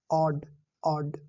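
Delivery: ऑड stretched slowly with an open aa; slow open odd. Shown as a demonstration. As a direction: draw out ऑड carefully. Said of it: "इन्हें बोल के देखिए दोस्तों".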